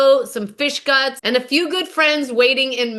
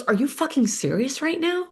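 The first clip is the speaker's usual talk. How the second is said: stunned disbelief; 2 semitones higher, 4 dB quieter than usual. Retold or imitated. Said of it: "She goes".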